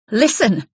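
loud, high, hostile order; sharp angry command. Acted. Angry wife warning Isabel on the phone, hostile. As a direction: bark it down the phone at her.

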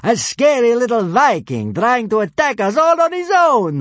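mocking centurion. A narrator's reading. Centurion mocks Fishlegs.

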